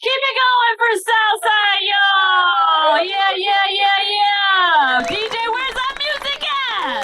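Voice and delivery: loud shouting emcee; announcer hype. Acted. Host calling for applause for Southside, loud. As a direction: pump up the crowd like an emcee.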